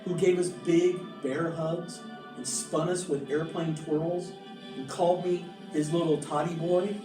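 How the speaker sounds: ordinary delivery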